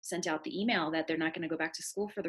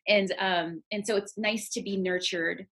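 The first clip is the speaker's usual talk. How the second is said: ordinary delivery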